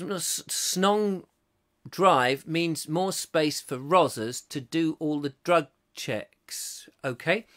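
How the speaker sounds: normal talking voice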